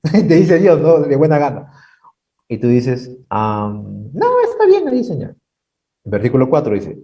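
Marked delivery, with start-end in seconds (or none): 3.3-5.4 mocking whiny excuse. Preacher imitating a stubborn believer refusing God, in a squeaky voice.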